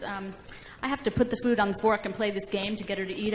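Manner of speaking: ordinary delivery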